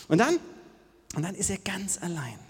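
ordinary talk

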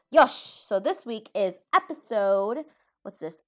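ordinary delivery

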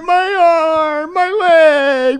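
very high, drawn-out wail; hammed-up child howling in pain; fake injured kid. Pretend kid hit by ball.

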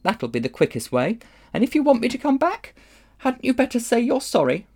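high, prim demand for an apology; rather cold. A narrator's reading. Polly wants an apology from Diggory, cold and prim. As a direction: pitch it up and stay aloof. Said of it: "rather coldly".

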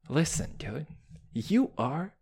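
usual speaking voice

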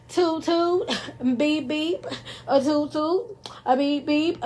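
high playful sing-song horn noises, half laughing; toot toot beep beep. Pretend. Tooting her own horn over her work praise, playful high sing-song. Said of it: "to toot my own horn".

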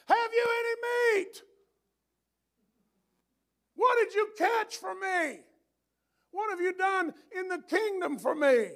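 high booming call down from the clouds; God yelling down. Retold or imitated. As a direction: yell it down from heaven, demanding. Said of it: "he might stand on that cloud and yell down".

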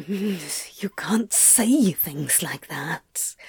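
slow unvoiced hiss; hissed whisper. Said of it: "Crowley hissed".

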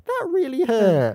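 high, slow, drawn-out whine; mock hurt complaint. Fan acting Nardole punched on arm, mock wounded. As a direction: complain loudly, stretching the words.

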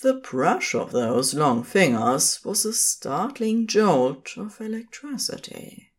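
ordinary talk

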